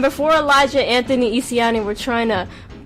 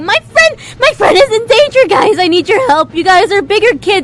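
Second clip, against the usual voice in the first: put-on very high, loud panicked child voice; a scrawny kid begging for help, frantic; screaming little kid; 9 semitones higher, 8 dB louder than usual. Little kid Twig begging bigger kids for help, high frantic scream. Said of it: "He ran up to us screaming".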